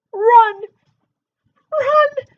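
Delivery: high, loud yell; king shouting to run. Acted. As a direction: warn everyone to flee. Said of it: "shouted the King Henry the Egg".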